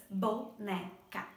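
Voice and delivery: "Boneca" in three slow, separate syllables. Shown as a demonstration. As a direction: count out "Boneca" as Bo, ne, ca with gaps. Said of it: "ela tem três sílabas".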